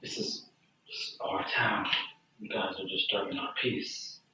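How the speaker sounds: quiet, measured townsman's warning; softly spoken, restrained threat; low-key warning. Acted. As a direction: warn them off under your breath. Game master voices hostile townsman.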